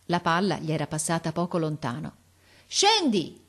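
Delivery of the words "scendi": high, sharply falling order; imperious, irritated shout. A narrator's reading. Officer orders boy down, irritated shout. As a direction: command it with impatience. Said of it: "imperioso e irritato".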